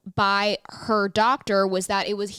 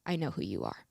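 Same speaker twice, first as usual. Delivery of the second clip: low, hushed imitation of his reply; grave, knowing tone; 6 semitones lower, 11 dB quieter than usual. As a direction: drop low and quiet, weighty. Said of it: "I remember the tone of his voice".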